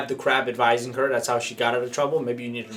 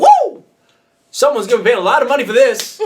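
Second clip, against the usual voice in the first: astonished shout; 9 semitones higher, 8 dB louder than usual. Retold or imitated. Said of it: "I'm like".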